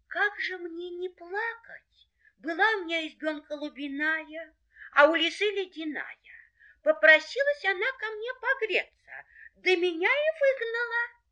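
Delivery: high, plaintive hare voice; weeping little hare. Acted. Hare tells dogs of losing his hut, tearful. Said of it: "опять идёт да плачет".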